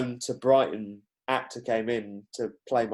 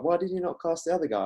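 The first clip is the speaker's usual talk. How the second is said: higher, quick imitation of a lecturer; questioning lecturer; 6 semitones higher, much faster than usual. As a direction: retell the lecturer's question, pitched up and rapid. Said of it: "my lecturer was like".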